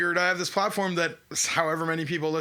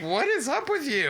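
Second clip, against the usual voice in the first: high-pitched imitation of someone pissed off; mock indignation; 11 semitones higher than usual. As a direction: mimic an exaggerated, offended complaint. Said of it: "Huey got all pissed".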